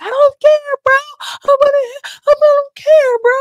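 very high, loud squealing imitation; mock frantic yell. [name] to her second, a very high mock shriek. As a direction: shriek it like she won't be calmed.

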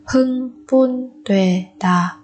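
slow Hưng Bun Tuệ Đà in separate beats; syllable by syllable. Shown as a demonstration. Teacher splits word by syllable. (đọc chậm Hưng Bun Tuệ Đà từng âm tiết)

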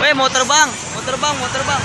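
excited. Real, not acted. Watching plane take off, excited.

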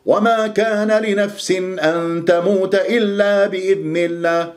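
وما pushed high and hard, rushing the verse; forced stress. Retold or imitated. Mocking a wrong reciter, forced stress. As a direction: imitate a reciter who stresses and attacks وما. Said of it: "هكذا ينبر ويهجم".

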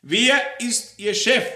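high, shouted interrogation; forceful, angry questioning; loud demand. Acted. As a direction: fire the question at full volume.

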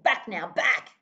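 loud barked command; father roaring at the dingoes; thundering. A narrator's reading. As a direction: drive the dogs back with force. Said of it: "he thundered".

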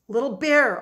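loud, high desperate call; frantic cry. A narrator's reading. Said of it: "Omri cried frantically into the blankness".

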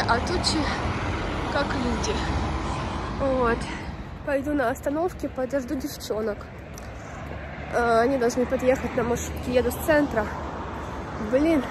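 normal talking voice

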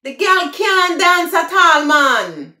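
high, loud Jamaican patois. Shown as a demonstration. Showing viewers the patois version, in Jamaican patois. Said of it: "that's the broken English".

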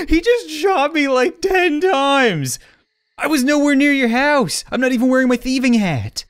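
wounded innocence, mock outrage; indignant outrage. Real, not acted. Outraged after being shot.

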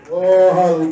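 ordinary delivery

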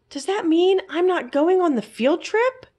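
high anxious questioning voice; a small mouse fretting upward; worried little mouse. A narrator's reading. Class pet fearing he'll miss the trip, high worried mouse voice. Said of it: "Fergus thought".

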